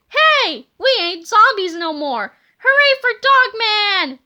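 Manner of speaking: high, loud cheer. Acted. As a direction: celebrate being freed. Freed cops cheer.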